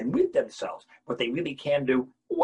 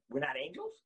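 normal talking voice

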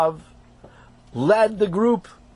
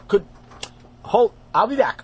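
plain reading voice

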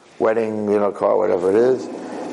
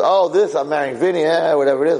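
higher-pitched, dismissive acted reply; breezy brush-off; 6 semitones higher, 3 dB louder than usual. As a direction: wave the question away lightly. Bride brushes off the cross.

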